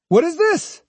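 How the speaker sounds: high-pitched angry demand. A narrator's reading. Swordsman demanding an explanation from the girl, angrily. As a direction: turn on her angrily. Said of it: "turned on her angrily".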